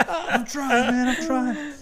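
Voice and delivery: imitating himself. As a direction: squeak it out while laughing. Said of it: "and I'm just like".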